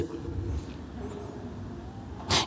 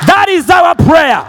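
ringing bold prayer; full-force preaching; 7 semitones higher than usual. Real, not acted. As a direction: boldly declare it to the church.

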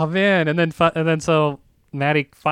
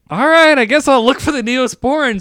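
mock resignation; 7 semitones higher, 5 dB louder than usual. Resigned going along.

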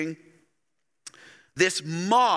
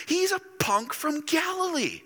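much higher, mocking disbelief; scoffing townsfolk; 12 semitones higher than usual. Preacher voicing synagogue crowd mocking Jesus, scornful and high. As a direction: sneer at the upstart from Galilee.

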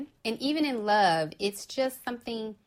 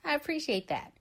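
touched thanks; 7 semitones higher than usual. Retold or imitated. Friend thanking someone for a good-luck text, sweetly touched. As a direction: melt a little at the kind text. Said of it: "It's like".

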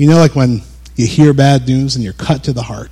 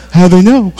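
mock alarm; 7 semitones higher, 4 dB louder than usual. Pretend alarmed reaction.

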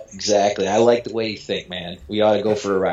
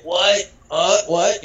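mock groggy waking; 7 semitones higher, much slower than usual. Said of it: "put my best, you know, Tylenol P M face on".

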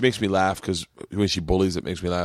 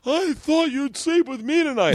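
put-on high, slow child's whine; dad imitating his daughter's tearful whine; imitating crying daughter. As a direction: mimic a tearful little girl. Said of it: "Isla's crying".

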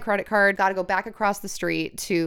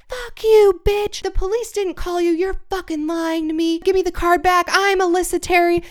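high, loud mocking yell of the thief; retold screaming; 8 semitones higher, 4 dB louder than usual. Thief screaming at the narrator in the bakery, shrill and loud. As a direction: shriek it at her like a cornered thief. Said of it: "the woman just starts fucking screaming".

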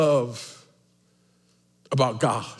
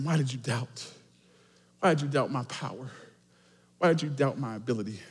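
soft, low, quickened questions; gentle Jesus asking; 3 semitones lower, 7 dB quieter, faster than usual. Retold or imitated. Jesus asks Peter about his doubt, softly. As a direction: ask it quietly, almost to one person. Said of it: "Then he asks him".

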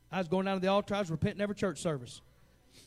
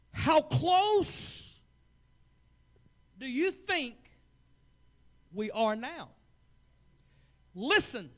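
loud, high fiery sermon warning; preaching at full force; 7 semitones higher, 6 dB louder than usual. Acted. Preacher warns his church, loud and high. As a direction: hammer the warning home, preacher style.

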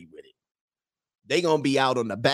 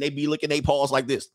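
ordinary delivery

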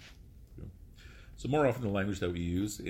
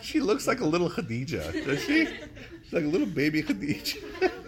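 louder, higher, through real laughter; laughing tease; 11 semitones higher, 5 dB louder than usual. Real, not acted. Teacher joking about a student's name, laughing and high. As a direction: tease them, laughing as you talk.

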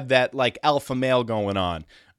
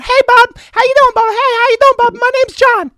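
much higher and much louder, manic squeal; John the wired boss; 11 dB louder than usual. Retold or imitated. Coked-up landscaping boss greeting the twelve-year-old, shrieking high and loud. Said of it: "Talks like that".

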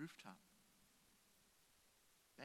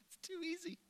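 high laughing aside; giggling comment; 13 semitones higher than usual. Real, not acted. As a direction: laugh it out, amused. Laughing aside between stories.

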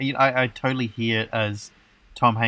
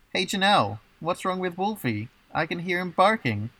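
higher voiced worried question; quoted movie line; 7 semitones higher than usual. Host reads the week's quote in a lightly higher voice. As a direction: lightly act out a worried neighbour calling over. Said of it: "goes a little something like this".

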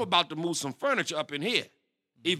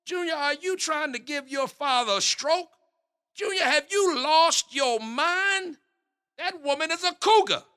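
shocked outrage; 8 semitones higher than usual. Acted. Father hearing his son's date is forty, shocked outrage. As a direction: let the shock explode out of you.

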